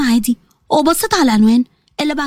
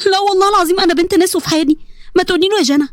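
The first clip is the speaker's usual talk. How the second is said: put-on high, distressed girl's voice; pleading; 4 semitones higher than usual. Girl defending her honour to her blackmailer, high pleading. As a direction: protest innocence in a raised, pleading tone.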